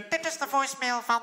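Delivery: lightly voiced female computer voice; robot voice. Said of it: "die vrouwelijke computerstem die zegt zo".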